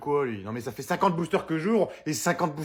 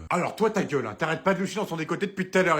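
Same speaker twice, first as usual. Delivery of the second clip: normal talking voice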